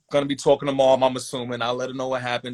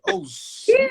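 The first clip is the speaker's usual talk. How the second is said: a much higher, startled oh shit; sudden alarm, voice jumping up high; alarmed realization. Retold or imitated. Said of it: "I am like".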